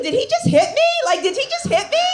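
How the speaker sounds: high, dazed inner question; stunned disbelief. Retold or imitated. Woman realizing her boyfriend hit her, high and dazed. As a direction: stay sweet but stunned. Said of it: "you're thinking".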